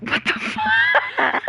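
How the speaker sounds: drawn-out, very high disbelief with a laugh; laughing shock, stretched slowly; stunned. Real, not acted. Gamer reacts to a friend's silly quip, stunned laugh.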